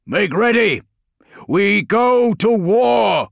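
commanding shout; rallying war cry; Guilliman's battle cry. Acted. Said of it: "he called".

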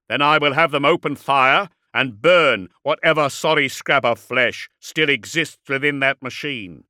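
loud, projected warlord threat; threatening warlord. A narrator's reading. Warlord threatens to burn the dreadnought, forceful and menacing.

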